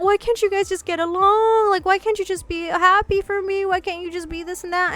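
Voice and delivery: high-pitched mock-tearful pleading; imitated crying plea. Friend begs her and grandma to get along, imitated crying. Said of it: "Jade starts crying, saying that".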